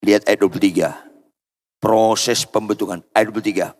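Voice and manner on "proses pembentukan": usual speaking voice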